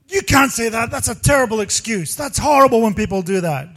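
high, loud mock outburst of an upset aunt; imitating angry aunt. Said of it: "goes nuts on me".

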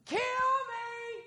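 very high, soft falsetto cry; hammed-up high-pitched plea; mock desperate wail. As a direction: wail it out long and thin. Comic acting of Moses pleading.

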